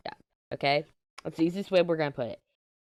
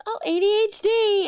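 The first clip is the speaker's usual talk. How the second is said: high, loud mocking squeal; gushing mock enthusiasm; 5 dB louder than usual. Mocking people who think ADHD is cute, gushing. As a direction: imitate someone thrilled by a cute diagnosis.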